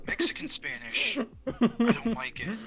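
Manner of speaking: giggling through the words; laughing too hard to finish. Real, not acted. Breaks into laughter mid-sentence.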